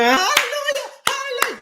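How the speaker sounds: falsetto hallelujah, very high then dropping; churchy mock praise. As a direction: mimic a shouting church lady, sky-high. Mocking churchgoers again.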